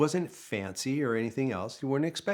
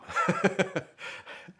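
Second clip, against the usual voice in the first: breathy laugh; 8 semitones higher than usual. Real, not acted. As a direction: laugh under your breath. Laughs at the memory.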